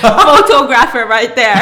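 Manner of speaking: slow, laughing through it. Real, not acted. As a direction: tease him while cracking up. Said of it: "laughing about husband".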